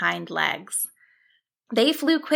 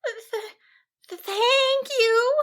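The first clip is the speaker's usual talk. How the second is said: high, slow stuttered thanks; a stunned little sheep can barely get it out; stammer; slower than usual. A narrator's reading. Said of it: "Cassandra stammered".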